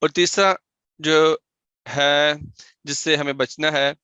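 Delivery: usual speaking voice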